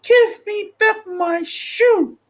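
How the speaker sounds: high, slow, indignant demand; a small girl insisting on her stolen shoe; angry little girl. Acted. Dorothy demands her shoe back from the witch, angrily. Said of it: "grew angry and said to the witch".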